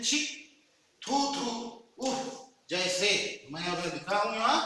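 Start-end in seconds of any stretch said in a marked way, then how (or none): 0.0-2.1 mild disgusted "छी"; disgusted exclamation. Shown as a demonstration. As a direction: spit out "थू थू" in disgust. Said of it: "घृणा के भाव को प्रकट करने वाले शब्द".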